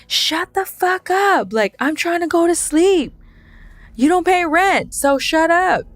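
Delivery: retold yelling. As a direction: yell it like you're fed up with the noise. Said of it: "Why are you yelling at me like that?".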